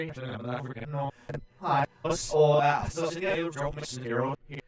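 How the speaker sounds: garbled alien voice babble; alien gibberish. Acted. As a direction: babble nonsense through an alien filter. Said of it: "Did anybody understand it?".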